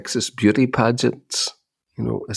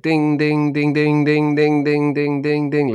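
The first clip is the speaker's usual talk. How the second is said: sing-song higher ding ding like a phone alert; mimicked phone dings; 5 semitones higher, slower than usual. To his listener, aping his wife's phone pinging with messages. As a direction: imitate a phone pinging nonstop. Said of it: "You're like".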